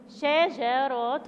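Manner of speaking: شجرة drawn out very slowly; slow teaching pronunciation of شجرة; syllable by syllable. Shown as a demonstration. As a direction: stretch each syllable of شجرة for young learners. Teacher models word syllable by syllable.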